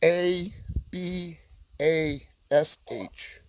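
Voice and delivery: ordinary talk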